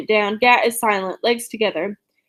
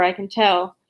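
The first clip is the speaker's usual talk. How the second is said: ordinary delivery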